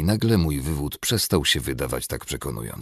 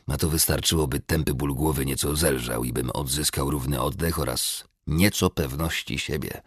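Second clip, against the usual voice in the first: ordinary delivery, nothing marked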